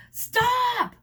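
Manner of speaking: high loud shriek. A narrator's reading. Harriet screaming as they crash, shrieking. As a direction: scream stop. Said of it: "Harriet shrieked".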